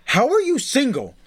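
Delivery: high, drawn-out mock disbelief; incredulous nurse. Acting out the baffled nurse.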